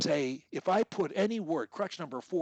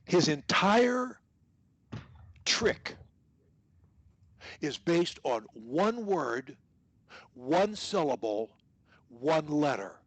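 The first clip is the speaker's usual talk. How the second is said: slow emphatic; slower than usual. Shown as a demonstration. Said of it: "what I mean by that is this".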